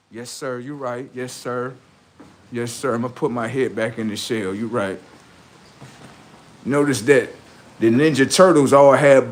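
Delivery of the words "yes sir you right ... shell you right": much softer, lower mock submissive voice; mock meek reply; cowed yes-sir. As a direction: mumble it like a scolded kid backing down. Voicing a cowed opponent answering.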